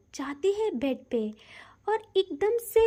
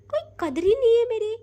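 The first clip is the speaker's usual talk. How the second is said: louder, higher and fast, hammed-up sulk; mock indignant complaint; 5 semitones higher, 5 dB louder, faster than usual. A narrator's reading. Wife pretends to be hurt after all her effort, mock indignant and fast. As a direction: blurt out the complaint in playful outrage.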